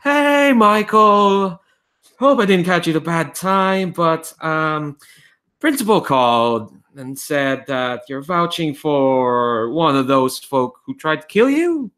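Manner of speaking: cheery voice calling through a gadget; cheery caller. Acted. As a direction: chirp a loud, drawn-out hello then chat on brightly. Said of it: "a quite cheery voice".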